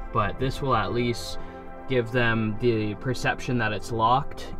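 ordinary delivery

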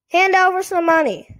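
high, loud, quick puppet demand; squeaky dummy demanding payment, fast; Slappy. A narrator's reading. Dummy demands pay at the party, high quick squeak. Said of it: "made Slappy declare".

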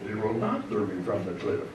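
lightly voiced Jedi master for laughs; a hint of a mock-solemn command; Yoda. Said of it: "was he like Yoda and just said".

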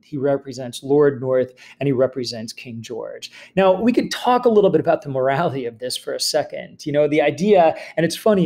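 ordinary talk

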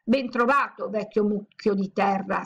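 ordinary delivery

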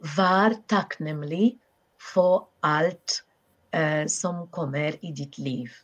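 slow, careful Norwegian. Shown as a demonstration. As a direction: spell out the Norwegian phrase slowly. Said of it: "In Norwegian".

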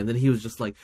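normal talking voice

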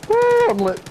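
very high, gleeful cheer; excited whoop. Real, not acted. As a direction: whoop it out in triumph. Celebrating in game.

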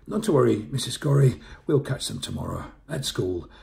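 loud, falsely breezy reassurance; over-hearty doorstep politeness; forced smile. Acted. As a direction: smile through it and brush it off. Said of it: "Forcing a smile".

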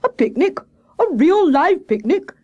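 high, loud cry of amazement; Hare's high-pitched, eager outburst; excited shout. A narrator's reading. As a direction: shout it in a high, thrilled character voice. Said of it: "shouted Hare".

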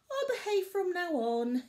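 put-on a small boy giving in; young cub voice. A narrator's reading. Barley promises his dad, high child voice. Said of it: "young Barley said".

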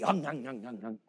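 low, fading nom nom chewing; munch it greedily like a kid; hammed-up cookie munching; mock munching. Kids eating the cookie.

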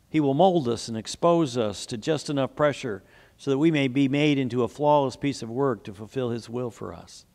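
usual speaking voice

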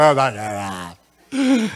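mock mumbled refusal. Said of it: "I was like".

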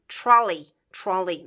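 Trolley said with an English "ee" ending, not "ei"; English pronunciation of trolley. Shown as a demonstration. Teacher modelling the English word for Romanian learners. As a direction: end Trolley in a short "ee" sound. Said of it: "Ea va fi pronunțată I".

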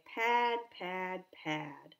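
quiet, sad plodding footsteps; soft plodding. Acted. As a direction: let the steps fade to almost nothing.

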